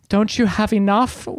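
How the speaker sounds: a hammed-up take on a Melania Trump accent. Quoting Wonder Woman's line to the villain, in a mock Melania Trump accent. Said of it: "In a, in a Melania Trump accent?".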